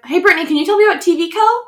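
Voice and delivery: high, fast cheesy commercial voice; hammed-up infomercial host. Mock ad setup for app.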